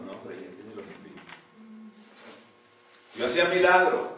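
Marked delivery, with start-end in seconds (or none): 3.2-4.1 high, loud indignant cry; protesta a gritos. Retold or imitated. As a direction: insist on it as if owed a place. Rejected man lists his deeds. (el hombre rechazado le reclama a Dios, gritando indignado)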